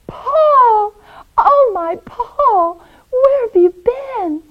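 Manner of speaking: high, loud, tearful-sounding joy; emotional, drawn-out high woman's voice; overcome reunion cry. Acted. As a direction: cry out to a lost love. Nora reunited with lost Paul.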